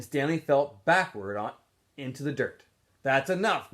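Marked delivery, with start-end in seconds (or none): 3.0-3.7 loud, higher yell to stop a fight. A narrator's reading. Counselor yelling at fighting boys, loud. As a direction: bark it to break them up. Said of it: "Mister Pedanski yelled".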